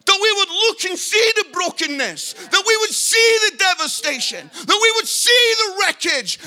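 loud, high, urgent preacher's plea; impassioned preaching. Real, not acted. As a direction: cry it out to the congregation at full force. Impassioned preaching at full force.

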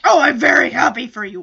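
loud, fast gruff voice. Acted. Man stands up and answers Punky, gruff. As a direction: blurt it out gruffly and loudly. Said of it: "he's really gruff".

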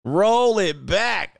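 slow, stretched "Roll it back"; drawn-out repeat. Real, not acted. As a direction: draw out both ROLL and BACK.

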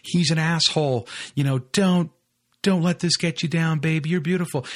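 put-on comforting girlfriends. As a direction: lightly play the consoling girls. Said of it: "five girls around her yelling".

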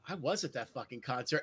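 low, very soft self-questioning; puzzled muttering to self. Retold or imitated. Drinker puzzling over an old ticket stub, muttered. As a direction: mutter it under your breath.

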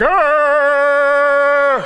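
long, loud held cry of dismay; hammed-up recoiling wail; mock horrified holler, drawn out; dad's recoiling groan. Dad recoils at period excuse.

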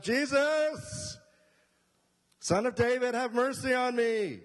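high, loud pleading cry; desperate shout. Acted. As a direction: shout it over the crowd, begging. Blind beggar shouting to Jesus.

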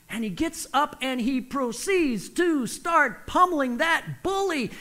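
normal talking voice

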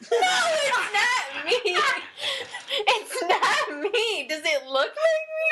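high, loud laugh-crying; laughing hard. Real, not acted. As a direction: laugh till it cracks. Laughing denial.

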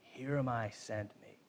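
quiet surrender. Retold or imitated. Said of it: "we know what we're gonna get".

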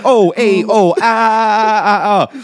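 hammed-up Italian hey-oh; Italian-modified Morse code. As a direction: stretch out Italian-style oh and ah signals. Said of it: "It's the Italian modified".

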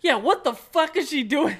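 high, loud exclamation; incredulous outburst. Real, not acted. Exclaiming at the movie version.

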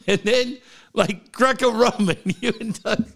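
ordinary talk, nothing marked

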